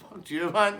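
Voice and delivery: high, loud retort; mock angry woman. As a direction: imitate an irritated woman telling him off. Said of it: "She's like".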